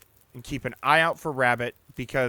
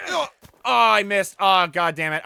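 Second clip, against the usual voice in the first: frustrated shout; 5 semitones higher, 6 dB louder than usual. Real, not acted. Missed the rabbit.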